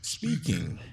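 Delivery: soft, processed voice from a smart pad; voice effect. Shown as a demonstration. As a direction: speak through a voice-changer effect. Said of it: "So now this is what I sound like".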